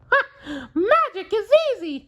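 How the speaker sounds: high cartoon mouse voice with a gleeful laugh; Mickey laughing. Mickey delighted that magic is easy, laughing high. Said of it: "he cried".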